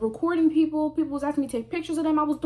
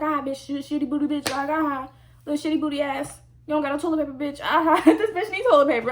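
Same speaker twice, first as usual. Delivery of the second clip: mock bully taunt; 4 semitones higher than usual. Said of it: "that's how she trying to make it seem".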